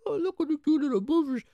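very high, sweet mock-cute voice; cutesy voice. Fish owners cooing at the cute puffers, high and sweet. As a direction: coo it in a high baby voice. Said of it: "They're like".

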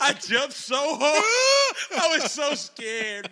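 high, drawn-out screaming retelling; terrified shriek. Retold or imitated. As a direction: yell it in mock terror, words stretched. Re-enacts terror at door bursting.

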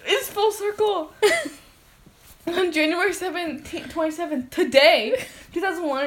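giggly, high and breathless; laughing. Real, not acted. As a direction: talk through leftover giggles. Still laughing after scare.

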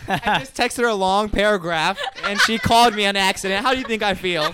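high, loud mock indignation; joking loud outburst. Real, not acted. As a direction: protest loudly over the laughter. Joking outburst over texting mishap.

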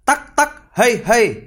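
high, loud calls to the horse; shout. Acted. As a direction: urge the horse on with a yell. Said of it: "Tí hon thét".

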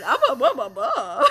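loud silly babble while laughing; silly babbling. Real, not acted.

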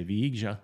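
Viega with a soft ZH, vee-EH-zhuh; tentative Portuguese pronunciation. Shown as a demonstration. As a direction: try Viega with G as in beige. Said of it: "how do you say".

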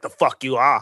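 light mock Boston accent on a tough retort; a hint of Boston accent, tossed off like a movie tough guy; Mark Wahlberg Boston accent. Podcaster quoting a character's retort, light Boston accent. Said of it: "pull out the Mark Wahlberg Boston accent".

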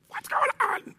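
very high, squeaky mock bewilderment; baffled customer. Waiting customer seeing empty tables, squeaky mock bewilderment. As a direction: squeal it in an exaggerated falsetto.

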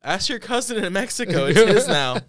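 loud high mom call from another room; yelling across the room. Retold or imitated. Mom calling back.

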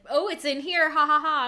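hammed-up fake surprise; fake innocent. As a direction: pretend you just found it.

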